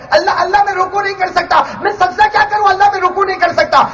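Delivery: high-pitched cry of helplessness; impassioned preaching. Acted. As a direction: act out the sinner who cannot bow, voice held high. Voices sinner unable to bow. (اونچی آواز میں بے بسی کی پکار)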